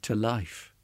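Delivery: hushed plea, well under normal volume; silent prayer. A narrator's reading. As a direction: pray it quietly, almost to yourself. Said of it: "with the prayer on his lips".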